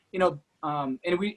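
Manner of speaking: ordinary delivery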